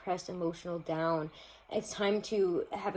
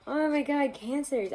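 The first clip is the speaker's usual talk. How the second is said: surprised dismay; 8 semitones higher, 4 dB louder than usual. Real, not acted. Reacting to the Tower card.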